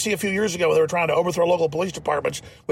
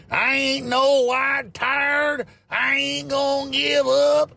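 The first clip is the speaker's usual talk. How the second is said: high, drawn-out mock Southern twang; slow, hammed-up Southern accent; fake Southern drawl; 10 semitones higher, much slower than usual. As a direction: mimic a politician's put-on Southern drawl, stretched and high. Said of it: "she didn't talk like somebody from Kentucky or Texas".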